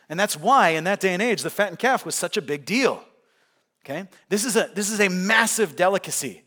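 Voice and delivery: loud, high preaching push; full-force preaching. Acted. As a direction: preach it out to the crowd.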